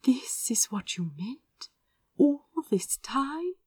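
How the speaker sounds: hushed, breathy whisper of wonder; awed whisper. Acted. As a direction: whisper it, amazed. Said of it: "Azra's voice was soft with awe".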